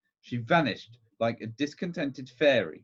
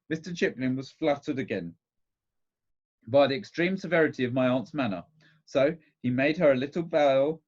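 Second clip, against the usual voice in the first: plain reading voice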